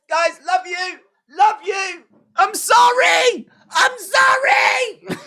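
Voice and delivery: high hammed-up wail, slow; wailing apology. Triple H wailing sorry to the fans, hammed-up. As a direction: wail the apology like a breaking heart.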